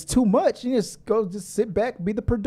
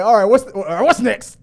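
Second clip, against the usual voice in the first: irritated host; 4 semitones higher, 7 dB louder than usual. Retold or imitated. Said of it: "he got so irritated".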